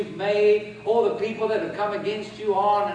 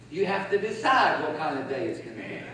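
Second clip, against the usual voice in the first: usual speaking voice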